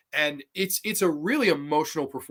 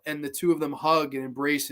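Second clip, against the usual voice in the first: ordinary talk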